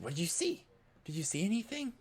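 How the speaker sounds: put-on high, anxious boy's voice; jittery whisper-quick worry; scared kid glancing over your shoulder; nervous kid.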